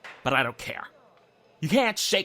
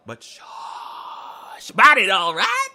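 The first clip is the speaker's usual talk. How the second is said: mock secrecy; 4 semitones higher, 8 dB louder, much slower than usual. Telling him to keep it secret.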